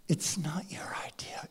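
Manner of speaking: unvoiced, soft whisper to the crowd; preacher whispering a point to listeners; hushed aside. Real, not acted. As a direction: lean in and whisper it.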